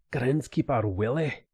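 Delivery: exaggerated cartoon Scottish accent; hammed-up Scottish cartoon voice; Groundskeeper Willie. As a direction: put on a thick mock-Scottish brogue. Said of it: "it's like the, the groundskeeper".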